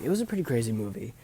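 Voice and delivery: ordinary talk, nothing marked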